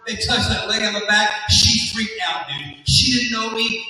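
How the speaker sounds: ordinary talk, nothing marked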